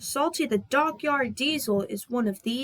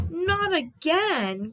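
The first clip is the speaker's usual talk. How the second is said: dismayed cry; 6 semitones higher, much slower than usual. Acted. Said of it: "cried Percy".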